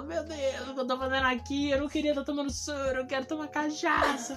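put-on drunk woman. Imitates drunk woman in hospital. (imitando uma mulher bêbada, voz mais aguda)

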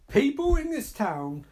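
high, indignant repeat; mock outrage. Viewer objects to film dialogue, high mock outrage. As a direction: throw the quote back in outrage.

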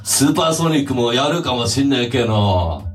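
put-on low, kind Hiroshima old man; see them off gently and low; gentle send-off. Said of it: "朝優しく送り出してくれる時". (低く優しい見送りの声)

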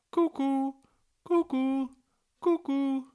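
very high, ringing two-note cuckoo; loud, high, evenly repeated bird call; loud clear cuckoo call. A narrator's reading. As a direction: call out like a cuckoo clock striking. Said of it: "called the cuckoo in her loud voice, clear voice".

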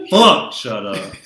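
slow, loud "Fuck, shut up". Real, not acted. Louder repeat to cohost.

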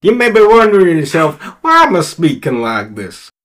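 big folksy American cowboy welcome with swooping pitch; hokey American western twang; put-on American cowboy accent. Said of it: "putting on an accent".

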